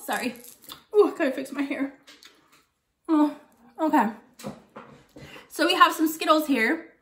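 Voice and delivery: normal talking voice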